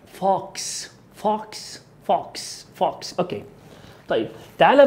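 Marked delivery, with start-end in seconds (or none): 0.1-3.2 softer American فوكس, open "ah" as "faaks"; نطق أمريكي. Shown as a demonstration. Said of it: "مش فوكس". (لكنة أمريكية، فوكس بألف مفتوحة)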